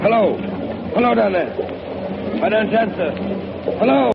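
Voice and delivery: calling out. Acted. Calling down to silent engine room.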